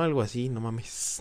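ordinary delivery

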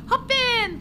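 bright high call of a stranger; a cheery driver shouting hop in. Acted. Voicing the driver calling to the player, high and loud.

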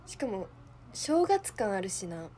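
normal talking voice

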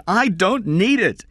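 very high, loud protest; screamed refusal. A narrator's reading. Kathy insists she doesn't need money, screaming. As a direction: cry it out in refusal. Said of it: "Kathy screamed".

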